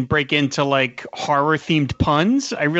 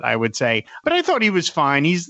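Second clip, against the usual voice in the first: normal talking voice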